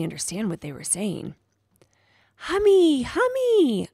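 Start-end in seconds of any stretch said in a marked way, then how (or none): 2.4-3.9 put-on high, loud toddler voice; squealing little-kid holler, pitched way up; little child calling out. A narrator's reading. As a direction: call it out excitedly like a tiny child. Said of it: "a little voice called out".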